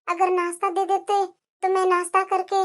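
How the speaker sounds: barely voiced, begging the mother-in-law for food; weak, quiet plea. Daughter-in-law begs her mother-in-law for breakfast, weak and quiet.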